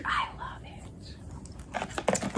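breathy little whisper of delight. Real, not acted. Whispering her love for the face mist to viewers. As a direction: hush it like a secret pleasure.

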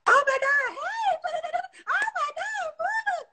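squealing very high, drawn out; sky-high gushing hello; overexcited greeting. Retold or imitated. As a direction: gush at the dog like a thrilled fan. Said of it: "We be like".

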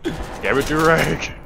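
loud exasperated yell; annoyed shout. Real, not acted. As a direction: yell in frustration. Scolding teammate.

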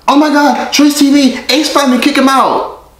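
shrieking high excited fan; imagined recognizing fan. Retold or imitated. Vlogger imagining a fan spotting him, shrieking high. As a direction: squeal it like a starstruck hater. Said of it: "someone's gonna recognize me and be like".

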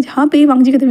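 ordinary delivery, nothing marked

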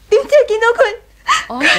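slow, tearful and breaking; crying. Acted. Wife telling her in-laws what she saw, crying. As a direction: sob the words out as it hits her. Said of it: "你喊咩啊".

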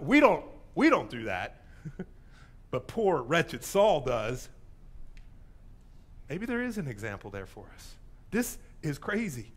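usual speaking voice

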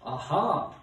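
thunderous storybook "aha"; thundering god. A narrator's reading. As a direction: boom it out like a god. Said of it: "thundered the voice of Guy".

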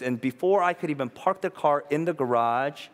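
ordinary talk

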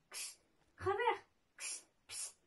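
timid squeak thinning into voiceless hisses; high little shooing voice, then whispered hisses. A narrator's reading. Goos shoos away a possible snake, squeaking. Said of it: "piepte hij".

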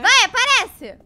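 shout. Real, not acted. Taunting the monster to appear.

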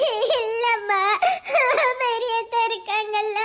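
slow tearful pleading; drawn-out crying explanation; wailing. Acted. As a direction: sob it out to mother. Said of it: "நீ ஒப்பாரி வச்சுதான் சொல்லணுமாக்கும்".

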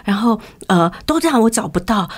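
normal talking voice

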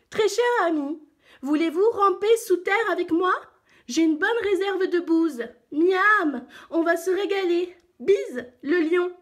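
high, loud reading voice; giraffe reading aloud. A narrator's reading. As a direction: read the letter out in a high, perky voice. Giraffe reads beetle's letter aloud. (girafe qui lit, voix haute et forte)

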